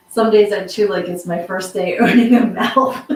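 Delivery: reading it through laughter; laughing. Real, not acted. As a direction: laugh while reading aloud. Reads funny comment, laughing.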